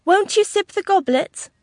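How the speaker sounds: high, loud entreaty; old gentleman imploring his beloved; urging suitor. A narrator's reading. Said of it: "urged the stranger".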